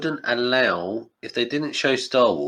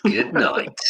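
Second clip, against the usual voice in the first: catchphrase delivery; 6 semitones higher than usual. Shown as a demonstration. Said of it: "one of my catchphrases".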